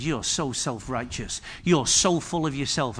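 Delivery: low, hushed reproach; retold rebuke. Pastor's wife scolding the man she grabbed, low quiet reproach. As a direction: quietly re-enact a telling-off.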